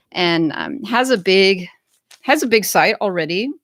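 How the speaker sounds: ordinary talk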